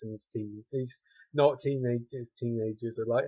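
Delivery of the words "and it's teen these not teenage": normal talking voice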